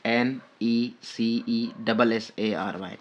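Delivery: slow letter-by-letter dictation; spelled out slowly. Shown as a demonstration. Teacher dictates a spelling to students, slowly letter by letter. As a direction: spell it out slowly for note-takers.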